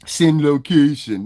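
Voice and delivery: loud, slow, drawn-out dare; confrontational demand. Real, not acted. Streamer demanding chatter's location, loudly confrontational. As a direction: stretch it out as a loud challenge.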